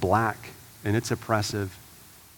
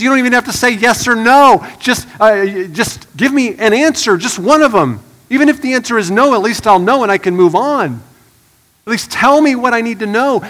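loud, high, driving sermon voice; rising to full preaching force; 8 semitones higher, 10 dB louder than usual. Acted. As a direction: preach it hard to the congregation.

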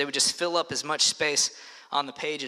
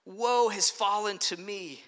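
mourner's cry; 5 semitones higher than usual. Acted. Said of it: "they would cry out".

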